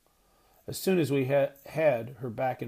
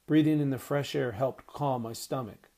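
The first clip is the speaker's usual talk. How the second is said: ordinary delivery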